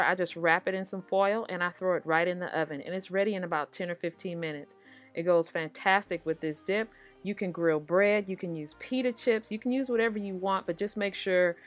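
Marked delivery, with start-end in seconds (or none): none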